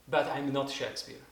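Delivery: ordinary talk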